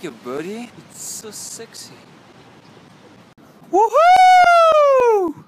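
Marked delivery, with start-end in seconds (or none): 0.9-1.9 unvoiced whisper. Whispered compliment. 3.7-5.3 high, loud whoop; joyful yell. Acted.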